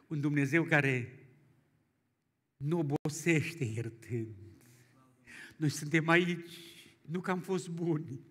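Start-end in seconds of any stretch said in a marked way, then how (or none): none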